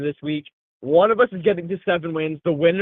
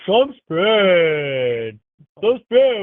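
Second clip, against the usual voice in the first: hype intro; 3 semitones higher, 4 dB louder, much slower than usual. Acted. Fantasy recap intro, hype.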